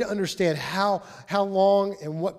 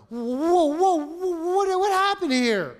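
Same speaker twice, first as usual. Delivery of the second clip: high, louder whoa whoa in disbelief; stunned surprise; 12 semitones higher, 5 dB louder, slower than usual. Retold or imitated. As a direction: gasp as the kid pulls away. Son leaves dad in dust.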